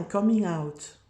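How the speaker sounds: "coming out" said with an English accent. Real, not acted. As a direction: pronounce "coming out" the English way, not French. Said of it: "j'ai un super accent anglais".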